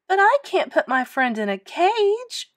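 high-pitched pleading protest; distressed boy, voice rising high; upset cry. A narrator's reading. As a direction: cry out in dismay. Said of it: "cried Lewis".

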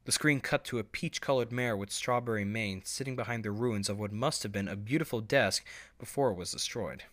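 ordinary delivery, nothing marked

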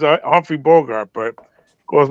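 ordinary talk

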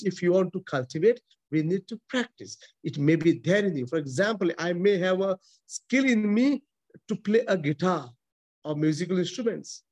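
normal talking voice

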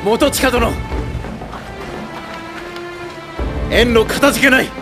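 projected greeting. Acted. Commander greets reinforcements from afar, projected. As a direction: call out thanks to an arriving ally.

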